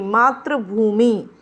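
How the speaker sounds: मातृभूमि said very slowly, falling; drawn-out demonstration. Shown as a demonstration. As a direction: stretch out each part of मातृभूमि. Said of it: "मातृभूमि में क्या अंतर है". (मातृभूमि को खींचकर धीरे बोलें)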